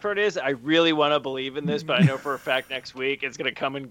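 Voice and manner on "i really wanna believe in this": usual speaking voice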